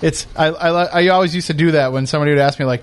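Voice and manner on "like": usual speaking voice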